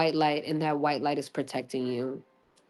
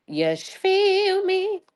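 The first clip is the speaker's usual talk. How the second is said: excited; 11 semitones higher, slower than usual. Real, not acted. Hyped about gossip.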